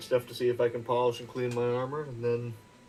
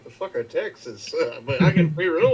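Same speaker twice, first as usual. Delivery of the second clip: underground guy; 5 semitones higher, 6 dB louder than usual. Retold or imitated. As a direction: play a baffled cave dweller, breaking into a laugh. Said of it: "Like a guy who lives primarily underground, just like".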